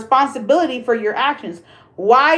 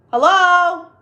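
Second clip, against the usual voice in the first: much higher, louder hello, wake up; sassy wake-up call; 7 semitones higher, 4 dB louder, slower than usual. Acted. Telling viewers they look like losers, sassy wake-up call. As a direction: snap them awake, sassy.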